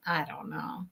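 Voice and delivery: usual speaking voice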